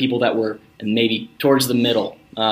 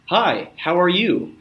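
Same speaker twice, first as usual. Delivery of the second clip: mock weak cold caller; 6 semitones higher, slower than usual. Shown as a demonstration. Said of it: "one of the big mistakes".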